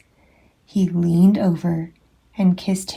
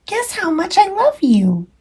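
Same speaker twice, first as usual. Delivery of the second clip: put-on high, small child-hare voice; sweet little bunny asking; 11 semitones higher than usual. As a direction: sound like a tiny eager bunny. Little Nut Brown Hare speaks.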